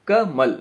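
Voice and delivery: कमल broken as "ka", pause, "mal"; कमल with a gap after the first syllable; split into syllables. Shown as a demonstration. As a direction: say कमल in two beats, short ka then mal. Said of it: "आप पहले का बोलते हैं".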